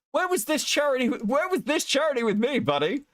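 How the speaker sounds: high, hammed-up wounded whine; mock betrayed outrage. Streamer mock-pleading with Chud, high hammed-up hurt. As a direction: play the jilted friend, overdone.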